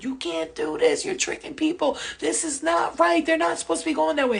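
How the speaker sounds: high, loud protest yell; screaming. Retold or imitated. Dreamer accusing the fake Jesus of tricking people, screaming. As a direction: cry out in outraged protest. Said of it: "I'm screaming at him, screaming".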